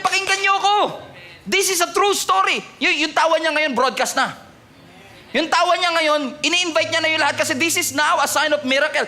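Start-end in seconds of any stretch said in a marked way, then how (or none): 0.0-2.6 Sarah announcing. Acted. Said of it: "Sabi niya".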